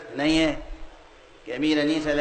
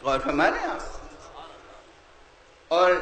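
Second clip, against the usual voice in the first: ordinary talk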